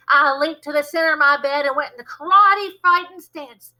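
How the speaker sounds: plain reading voice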